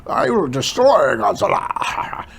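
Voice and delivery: grand, self-satisfied villain boast; mustache-twiddling villain. Retold or imitated. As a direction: ham it up like a cartoon villain. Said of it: "he comes off as this".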